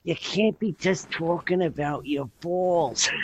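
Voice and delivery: put-on high voice, drawing out the last words; Freddie. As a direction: mimic an old-school pal setting you straight. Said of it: "He's like".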